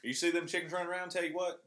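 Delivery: a hint of a country Southern accent; mock Southern trade-day local. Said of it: "talks like this all day".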